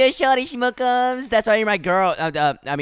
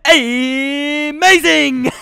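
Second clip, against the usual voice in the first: drawn-out cheer; 4 semitones higher, 4 dB louder, much slower than usual. Real, not acted. Game win exclamation.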